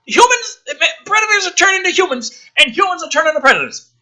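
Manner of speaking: high, fast, disbelieving recap; incredulous recap. Real, not acted. Commentator recapping the body-swap twist, high and incredulous. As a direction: spell out the absurd twist in disbelief.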